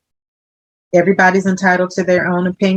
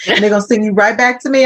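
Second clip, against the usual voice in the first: ordinary talk, nothing marked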